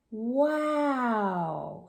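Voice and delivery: plain reading voice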